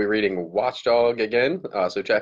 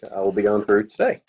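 plain reading voice

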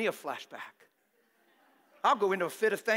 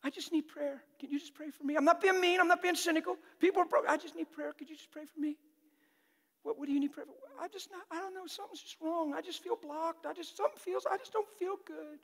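softer, small pleading voice; lightly voiced needy churchgoer; 7 semitones higher, 3 dB quieter than usual. Congregant begging the preacher for prayer, softly.

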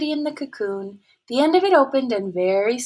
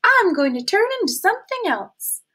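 much higher, bragging caterpillar voice; proud caterpillar; 10 semitones higher, 4 dB louder than usual. A narrator's reading. Caterpillar boasting to the snail, high proud voice. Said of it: "said the caterpillar".

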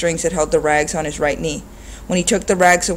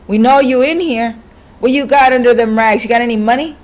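higher, louder street thug voice; menacing thug; 6 semitones higher, 6 dB louder than usual. A narrator's reading. Thug threatening the old man inside, louder and higher. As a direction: lean on him like a hoodlum.

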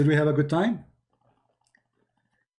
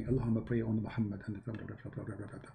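fading rote mumble; 5 semitones lower, 13 dB quieter than usual. Retold or imitated. Caller mumbling prayer after him.

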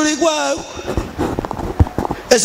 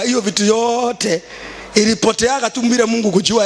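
usual speaking voice

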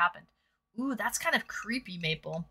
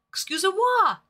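mock French; 10 semitones higher, 4 dB louder than usual.

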